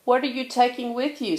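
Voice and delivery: high, quick little-sister question; light childlike question. A narrator's reading. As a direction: voice Sister as a young cub. Sister asking Brother.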